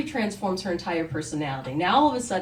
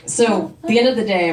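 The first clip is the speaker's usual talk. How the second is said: ordinary talk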